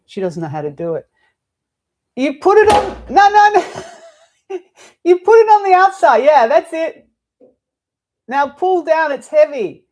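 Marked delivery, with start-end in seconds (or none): 2.2-9.9 much higher and louder, laughing directions; teacher coaching assistant with the blind, loud high calls; calling out instructions. Real, not acted. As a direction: call across the room, half laughing.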